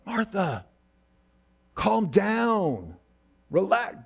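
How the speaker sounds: hushing an overwrought friend with a put-on voice; mock exasperated Jesus. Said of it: "It was like".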